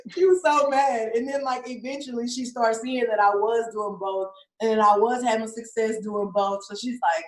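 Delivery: ordinary talk, nothing marked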